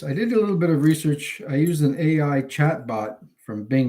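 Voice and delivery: ordinary talk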